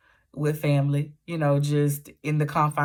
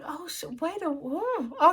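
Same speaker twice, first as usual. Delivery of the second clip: mock surprise; 13 semitones higher, 4 dB quieter, slower than usual. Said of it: "So I'm like".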